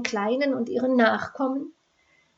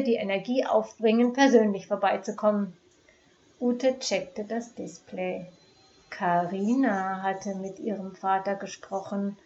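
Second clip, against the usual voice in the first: ordinary delivery, nothing marked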